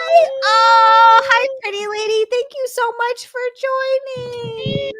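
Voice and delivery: high, loud, teary delight; overjoyed, voice breaking; emotional greeting. Real, not acted. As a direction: greet a friend near tears of joy.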